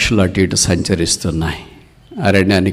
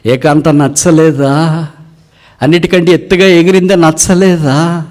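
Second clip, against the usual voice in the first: incredulous questioning; 9 semitones higher, 5 dB louder than usual. Acted. Hunter questions fallen eagle, high incredulous challenge. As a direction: press each question with a lifted voice.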